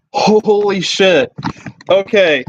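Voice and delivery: usual speaking voice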